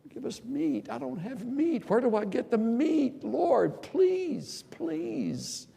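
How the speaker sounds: high, loud, whining plea for meat; whiny pleading. Acted. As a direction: beg like a desperate, exasperated Moses. Mocking people's demand, Moses pleading.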